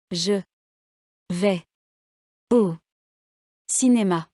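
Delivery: Je, vais, au, cinéma each said alone with long pauses; word by word. Shown as a demonstration. Teacher showing written words one at a time, word by word. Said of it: "Faites attention à l'accent tonique".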